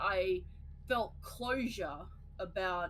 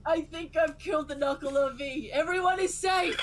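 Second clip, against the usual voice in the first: triumphant hero; 7 semitones higher, 7 dB louder than usual. Pretend. Triumphant game hero cry.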